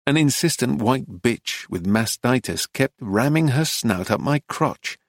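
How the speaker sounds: ordinary delivery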